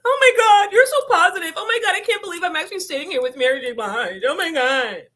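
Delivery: high, loud, fast fangirl gushing; mocking gushing fan. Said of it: "she went up there with".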